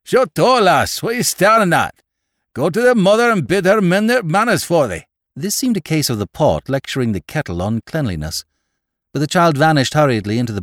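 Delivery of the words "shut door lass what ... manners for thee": high, loud farmer's rebuke; angry scolding. A narrator's reading. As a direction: shout crossly at the child. Surly farmer scolding the child.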